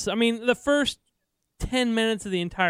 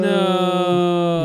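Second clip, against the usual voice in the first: loud, long-held mock cry; hammed-up villain's no; Darth Vader; 8 dB louder than usual. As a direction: bellow it and hold the vowel. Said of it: "the Darth Vader saying no".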